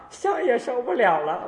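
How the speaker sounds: high, put-on aged voice; complaining old devotee. Old devotee tells master incense is beyond them, complaining. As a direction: plead inability like an old person. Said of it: "老了不行了".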